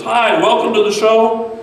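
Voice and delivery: put-on big kids' show welcome, high, loud and drawn out; Bear. TV bear opens the show for children, exuberantly welcoming. Plays Bear greeting the audience.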